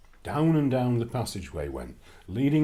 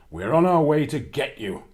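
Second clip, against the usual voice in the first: loud menacing giant threat; shouting giant; 8 dB louder than usual. Acted. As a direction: bellow it after them. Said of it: "he was shouting".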